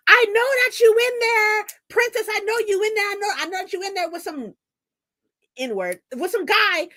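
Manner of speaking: high mock shout through a door; imitating a jealous ex yelling outside; mock yelling stalker.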